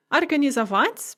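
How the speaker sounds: Организовать with the stress on its last syllable; stress on final syllable. Shown as a demonstration. Teacher reviewing infinitives, final syllable stressed in Организовать. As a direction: land the stress of Организовать on -вать. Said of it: "if the stress is on the at".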